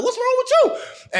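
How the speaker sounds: high, slow, laughing imitation of her; mock girl's reply. Imitating the chick asking why he's coughing, high and laughing. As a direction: play her baffled squeak through a laugh. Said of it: "She's like".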